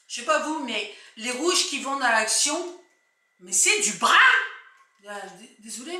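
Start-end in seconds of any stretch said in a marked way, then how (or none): 3.4-4.1 Picard accent. Real, not acted. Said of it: "mais je suis picarde".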